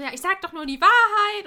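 high, loud, self-righteous retort; loud mock-defensive Tina; defensive snap. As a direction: insist you're only telling the truth. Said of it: "Und Tina so".

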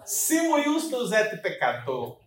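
slow, intoned priestly Latin; entonação de padre. Shown as a demonstration. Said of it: "Aí você bota a entonação pra parecer um padre". (latim lento com entonação de padre)

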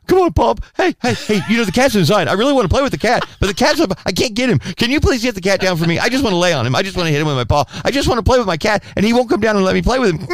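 high, eager pleading dog voice; bulldog Hank begging. Acted. Bulldog Hank begging his owner for the cat, high eager pleading. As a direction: beg like a dog desperate to play. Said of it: "Hank will come".

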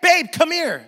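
high, loud calling scream; wife's scream. Retold or imitated. Wife screaming for her husband to come. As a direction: shriek for him across the house. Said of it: "she'll scream".

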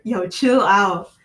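emphatic slow chill out. Pretend. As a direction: lean on chill, slowly. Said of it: "if it was, like, a year".

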